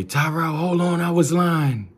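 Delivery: high, slow, hurried confession; high mother calling hold on; mother backpedaling. Retold or imitated. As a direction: stop them, sheepishly high. Mother confesses mid-fight.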